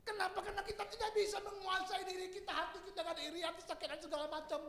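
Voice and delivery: pleading height with the volume pulled back; intense preaching. Real, not acted. Preacher explains envy to congregation, high intense preaching.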